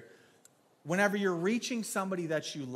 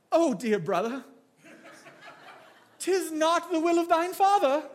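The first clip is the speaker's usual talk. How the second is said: much higher, louder, pompous archaic holy voice; mock King James talk; 11 semitones higher, 7 dB louder than usual. Pastor mocking churchy talk to his brother-in-law, hammed-up archaic holy voice. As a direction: ham up an old-fashioned churchy preacher. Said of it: "you don't talk like that".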